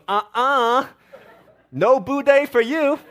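high, loud, slow, laughing no budae; mock sassy refusal. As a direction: wag a finger and refuse him with sass. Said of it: "she goes".

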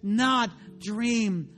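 full-force preaching. Acted. Preacher crying out to the congregation at full force. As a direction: preach it out to the back row.

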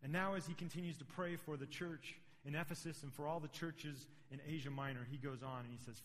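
ordinary delivery

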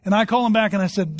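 ordinary delivery

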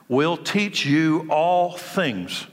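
slow and deliberate; slow emphasis. Acted. Preacher stressing the Spirit's promise, slowed right down. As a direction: lay out each word slowly. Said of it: "slowed scripture emphasis".

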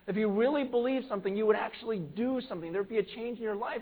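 rising sermon intensity; preaching at full force. Acted. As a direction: drive the point home from the pulpit. Preacher building to high intensity.

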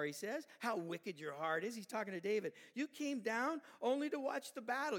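loud, scolding tirade; big brother tearing into David; angry brother. Acted. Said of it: "Eliab getting mad".

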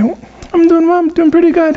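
lightly voiced guilty dieter; mock fibbing dieter. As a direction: sound fine while hiding the cake. Dieter fibs to coworker.

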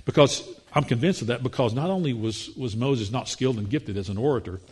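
ordinary talk, nothing marked

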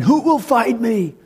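high, taunting giant's challenge. Acted. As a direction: call out the challenge like a mocking giant. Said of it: "with this giant saying".

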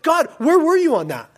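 high, loud challenge to God; frustrated complaint. Acted. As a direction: cry out in acted exasperation, demanding an answer. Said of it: "with this thing of like".

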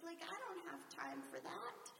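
high, put-upon complaint about being busy; a harried spouse throwing up their hands; exasperated reply. Retold or imitated. Spouse answering a request with mock exasperation. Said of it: "And you're like".

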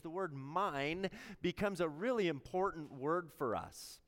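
usual speaking voice

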